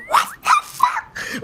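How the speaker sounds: high, drawn-out squeaky disbelief; hammed-up stunned squeal, word by word; mock shocked reaction. Acts out shocked Lady Macbeth reaction.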